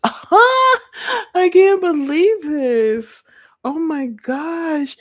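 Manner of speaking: high, slow, drawn-out squeal; laugh, then stretch it out high in delight; thrilled disbelief. Real, not acted. Streamer seeing her page appear on screen, thrilled.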